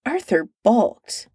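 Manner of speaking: usual speaking voice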